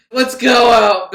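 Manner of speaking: loud, high, drawn-out party call; rowdy invitation. Acted. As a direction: holler it like you're hitting the town. Acted woman wanting a night out.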